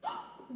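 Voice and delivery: a shocked high gasp; sharp high gasp. Acted. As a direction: suck in air, startled. Sees her rival arrive.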